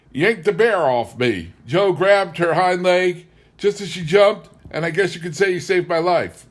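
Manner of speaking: casual proud husband. Acted. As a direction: toss off the danger lightly. Bob credits the dog.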